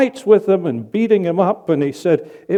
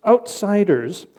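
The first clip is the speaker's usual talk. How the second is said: ordinary talk, nothing marked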